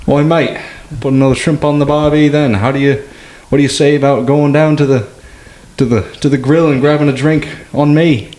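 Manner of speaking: rough Aussie accent slipping toward Irish; terrible Australian accent. Shown as a demonstration. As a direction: do a bad Australian inviting a mate to a barbie. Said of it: "you famously do a terrible Australian accent".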